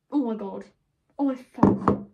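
loud startled oh my god; shocked outcry. Real, not acted. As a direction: blurt it out in alarm. Spilling hot candle wax.

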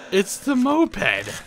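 slow, high, through laughter; laughing. Real, not acted. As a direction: crack up as you say it. Discovers the noise source.

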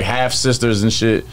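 ordinary delivery

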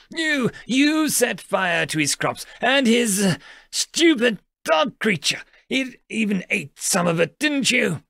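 high-pitched angry outburst; irate accusation. A narrator's reading. Youngest turning his ire on the Mantid, irate accusation. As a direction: hurl it at the culprit in fury. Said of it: "He turned and aimed his ire".

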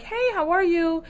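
high, perky greeting; mock cheerful girl. Imitating cheerful Jessica greeting Matthew, high and perky. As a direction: chirp it like a bubbly girl.

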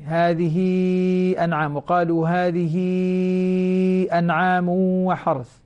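melodic chanted verse, held vowels; Quranic recitation. Shown as a demonstration. Teacher demonstrating the long connective vowel, chanted. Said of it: "هذه مد صلة كبرى".

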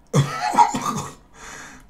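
squeaky breathless laugh; real laugh at his own joke; high wheezing laugh. Real, not acted.

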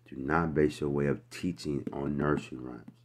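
ordinary talk

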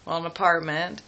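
ordinary talk, nothing marked

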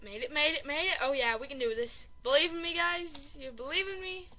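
ordinary talk

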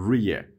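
ریه said "ree-e", gliding straight past the H; silent H. Shown as a demonstration. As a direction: show the syllables ریه with no H sound. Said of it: "یعنی اچ تلفظ نمیشه". (تلفظ «ریه» بدون صدای اچ)

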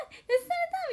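高い声ではしゃぐ; 裏返った黄色い声; はしゃぐファンのまね. Retold or imitated. ファンの女の子の歓声まね. (高い声ではしゃぐ)

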